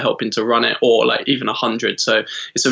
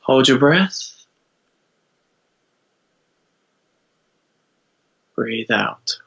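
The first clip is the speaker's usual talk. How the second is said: calm, unhurried meditation guide; guided meditation. Acted. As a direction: lead them gently into stillness.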